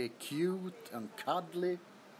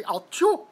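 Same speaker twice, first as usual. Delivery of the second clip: loud rising mock sneeze; a loud sneeze ending up; Norwegian sneeze; 5 semitones higher, 10 dB louder than usual. Imitating a Norwegian sneeze for viewers, loud and rising. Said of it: "Or if you're from Norway".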